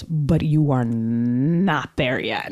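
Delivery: low, very slow and solemn; grave guru voice. Retold or imitated. The guru telling her she is not ready, low and solemn. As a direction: hand down the verdict like a sage. Retelling meditation guru's verdict.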